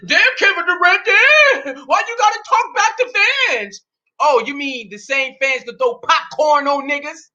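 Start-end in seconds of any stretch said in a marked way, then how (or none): 0.0-3.8 mock scolding fan. Host mimics critics scolding Durant, high mock whine.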